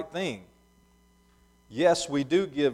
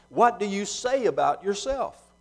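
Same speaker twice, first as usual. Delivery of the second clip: raised-pitch questioning, read aloud; probing question; 7 semitones higher, 3 dB louder than usual. A narrator's reading. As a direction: press him for an answer, in a reader's voice. Questioners press John, Scripture reading.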